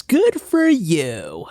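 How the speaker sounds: slow, stretched praise; drawn-out mock praise. Host praising his chocolate, slow and drawn out. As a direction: savour each word like a compliment.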